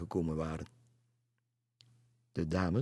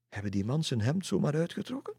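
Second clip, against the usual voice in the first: high, rising incredulous question; hesitant disbelief; 8 semitones higher, faster than usual. A narrator's reading. Lisse asks about stripping the man, hesitant disbelief. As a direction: check it as if hard to believe. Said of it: "zei Lisse aarzelend".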